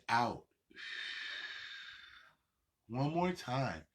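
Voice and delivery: guided breathing. Shown as a demonstration. Instructor leads the class through deep breaths, calm and low.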